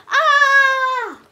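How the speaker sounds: loud, high falling cry; sudden high yelp of falling; falling scream. Acted. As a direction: shriek as he tumbles out of the tree. Character falls from tree.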